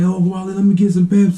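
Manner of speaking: put-on high-pitched kid asking a favor; pleading little brother. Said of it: "So my brother's like".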